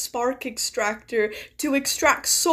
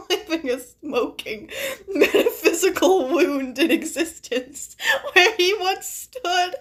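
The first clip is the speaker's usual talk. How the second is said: higher, words breaking up with laughter; laughing while reading; 4 semitones higher than usual. Real, not acted. Reader cracking up at a metaphysical wound in existence, laughing. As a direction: read it through helpless laughter.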